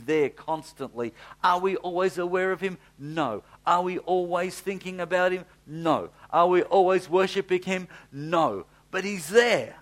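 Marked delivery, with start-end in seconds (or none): 1.4-9.7 louder rhetorical questions, each answered with a flat no; preacher pressing the church with questions; preaching questions. Acted. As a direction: challenge the congregation, then land the answer. Preaching call and response.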